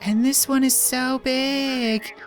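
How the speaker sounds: put-on bubbly high girl voice, excited; high girly voice. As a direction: squeal it like a cheerful girl. Voices female game character.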